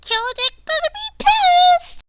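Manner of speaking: very high, tiny panicked imp; teeny imp voice. Acted. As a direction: squeak it out like a scared little imp. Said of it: "this little teeny voice".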